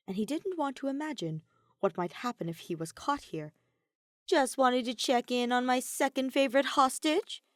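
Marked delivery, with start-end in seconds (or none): 4.2-7.4 Kokichi sneering, pitched high and louder; a sing-song taunt at the captive. A narrator's reading. Kokichi taunts his prisoner Kaito, sneering high. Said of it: "Kokichi sneered".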